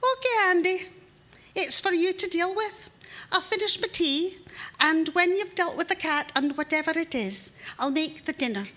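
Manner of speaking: high, loud and breezily bossy; wife calmly assigning the job to her husband. Acted. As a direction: hand the job over airily. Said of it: "and says".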